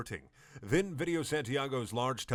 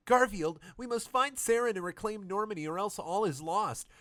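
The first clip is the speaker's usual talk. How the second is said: much higher hammed-up woman's voice; desperate Miranda; 10 semitones higher than usual. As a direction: cry out as hope fades. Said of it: "cried Miranda with losing hope".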